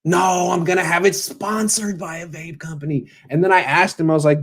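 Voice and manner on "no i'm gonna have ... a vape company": mock player voice. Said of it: "He's like".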